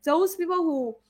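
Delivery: ordinary delivery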